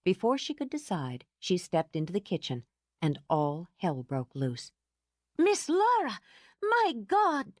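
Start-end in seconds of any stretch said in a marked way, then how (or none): 5.4-7.5 high, loud cry of alarm; alarmed shriek. Acted. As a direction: shriek it in horror. Housekeeper bursts out in alarm.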